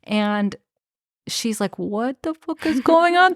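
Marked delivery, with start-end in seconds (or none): 1.8-3.3 high-pitched, imitated disbelief; mock-shocked exclamation; retold bewilderment. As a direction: act out a baffled reaction, voice jumping up. Retold shock at seeing herself.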